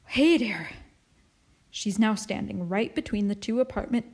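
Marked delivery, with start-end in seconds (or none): 0.1-0.7 winded, wheezy greeting; wheezing. A narrator's reading. Neighbour reaching the top of the stairs, wheezing. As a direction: puff it out after climbing the stairs. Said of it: "she wheezes".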